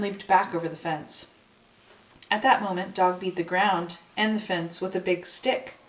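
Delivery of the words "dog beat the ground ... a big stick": ordinary delivery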